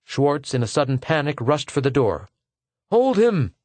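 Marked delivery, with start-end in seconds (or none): 2.9-3.5 loud, high-pitched command; urgent cry. A narrator's reading. Personnel man as the applicant rushes for the door, crying out. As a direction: cry out sharply for help stopping him. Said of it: "cried the other instantly".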